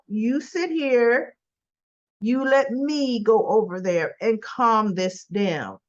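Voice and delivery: slow and deliberate, laying down the law; firm, slow instruction. Retold or imitated. Boyfriend ordering Abby to stay seated, slow and firm. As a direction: spell it out slowly so she stays put. Chance firmly telling her to sit.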